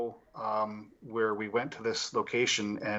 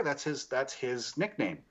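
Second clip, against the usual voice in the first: ordinary talk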